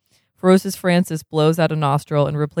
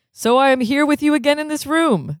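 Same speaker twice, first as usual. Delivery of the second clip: much higher, lilting cheerful doctor; sing-song doctor; 9 semitones higher than usual. Acted. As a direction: chirp it like a beaming doctor. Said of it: "the M D sings, kind of".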